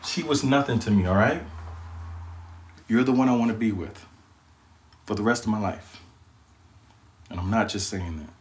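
low, tender, earnest voice; sincere reassurance. Acted. Boyfriend reassures girlfriend and proposes, low and sincere.

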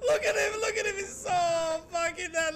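wheezing laugh cracking into words; hysterical laughter. Real, not acted. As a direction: laugh so hard it almost cries.

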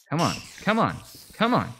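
high, loud repeated urging; urgent urging. Real, not acted. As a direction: push them on impatiently. Urging on in game.

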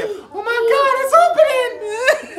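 put-on very high, loud, squealing kid panic; mimicking scared kids. Dad imitating the kids screaming as the door opens. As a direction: shriek it like a terrified child. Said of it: "I heard them say".

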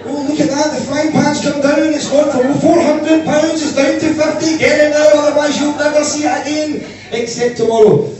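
high, loud, over-the-top sales pitch; hammed-up TV hawking with the mouth stretched open; shopping channel host chewing every vowel to sell a frying pan; shopping channel presenter.

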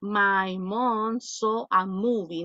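very slow, word by word, reading out a student's sentence; slow read-aloud. Shown as a demonstration. Teacher slowly reading Natalie's sentence aloud to the class. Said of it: "Nati, ¿qué querías poner?".

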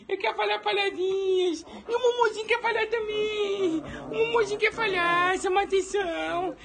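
put-on high, whiny baby-talk pet voice, near tears; pet's voice. Dad voicing his pet missing its father, high whiny baby voice. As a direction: squeal it like a homesick little pet.

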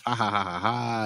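spoken-out "ha-ha" fake laugh; mock villainous laugh. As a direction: imitate the gloating crowd's laugh. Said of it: "all the Paklids laugh".

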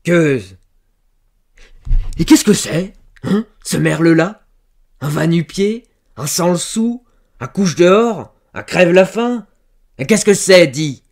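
loud, high, choking rage; sputter, then hurl scornful questions; spluttering with anger. A narrator's reading. Farmer demanding who the lover is, spluttering furiously. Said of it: "bredouillait de colère".

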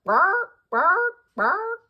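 high loud barking; seal barks. Shown as a demonstration. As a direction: bark like a seal. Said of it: "Seals bark".

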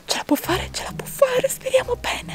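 soft, half-whispered encouragement; hushed hoping. Real, not acted. Girlfriend roots for Eric without disturbing him, hushed. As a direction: keep it hushed, barely voiced. Keeps silent so he won't laugh.

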